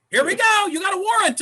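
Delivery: high, loud cop voice; mock cop. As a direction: announce the arrest smugly. Cop arresting him in story.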